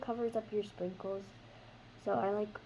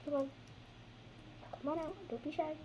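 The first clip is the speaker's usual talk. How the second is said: coaxing; 7 semitones higher, 8 dB quieter, faster than usual. Acted. Coaxing slime out of bag.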